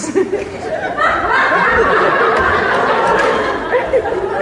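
high, prolonged henchman cackle; imp cackle. Acted. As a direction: manic high-pitched giggling fit. Imp cackles for his boss.

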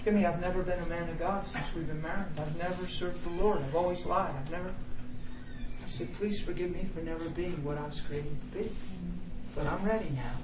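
subdued, sincere confession; retold contrite plea. Retells his contrite plea to wife.

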